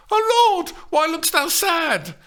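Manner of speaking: put-on high, loud horrified girl's cry; asked in horror. A narrator's reading. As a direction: gasp it out in horror as Juliet. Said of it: "Steph asked in horror".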